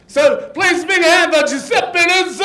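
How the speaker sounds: loud, high show announcement; rousing host shout. Real, not acted. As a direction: whip up the applause for an act. Host calls for applause.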